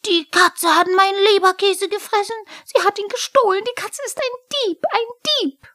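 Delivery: outraged high-pitched shriek; loud, very high kobold screech; Pumuckl. A narrator's reading. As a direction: shriek the accusation at the top of your voice. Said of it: "Pumuckl schrie".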